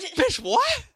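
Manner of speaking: very high, loud incredulous outburst; outraged disbelief. Retold or imitated. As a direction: replay a stunned, offended reaction. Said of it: "that's my reaction".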